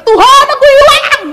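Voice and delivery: high, loud wail to God; shouting. Retold or imitated. Lost girl in heavy rain cries to God, shouting. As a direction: yell that you are lost, full voice. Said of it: "saya teriak-teriak".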